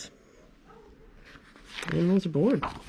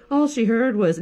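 ordinary delivery, nothing marked

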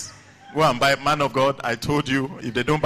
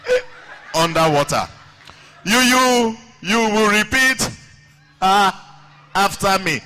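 raised, halting mock-stammer commands; stammering teacher; 5 semitones higher, 4 dB louder than usual. Teacher orders the class to repeat, mock stammering. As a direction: force the order out between blocks. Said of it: "he's a conch stammerer".

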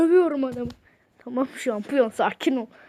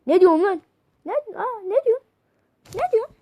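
mock squeal; 8 semitones higher, 4 dB louder than usual. Mocking the chatbot's reply in a squeaky voice. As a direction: squeak it like a baffled cartoon.

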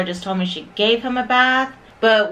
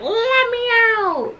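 puppy voice; 13 semitones higher than usual. Retold or imitated. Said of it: "he starts crying and whining and just be like".

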